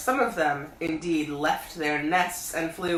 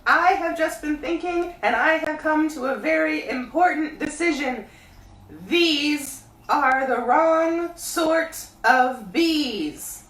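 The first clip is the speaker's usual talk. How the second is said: high, loud bear calling down; Pooh voice; 10 semitones higher, 6 dB louder, slower than usual. A narrator's reading. Pooh calling down to Christopher Robin, high bear voice. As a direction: call up from the honey tree like a bear. Pooh decides bees wrong.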